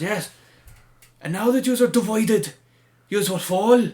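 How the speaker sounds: hammed-up Irish promo threat, loud and high; imitating Sheamus's Irish accent. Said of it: "his Irish accent is tremendous".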